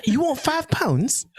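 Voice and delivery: high, hammed-up English accent; laughing attempt at an English accent. Shown as a demonstration. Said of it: "I feel like that's more English".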